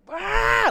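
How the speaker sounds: put-on sudden high, loud toddler "Rah!" roar; raging toddler roar. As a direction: belt out a kid's furious rage cry. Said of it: "where he's like".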